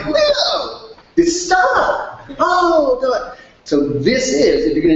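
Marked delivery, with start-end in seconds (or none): 0.1-3.4 very high, bright reunion call; excited buddy. Retold or imitated. To Leo, an old college buddy, a very high excited greeting. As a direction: sing out the name like an old friend. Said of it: "And I go".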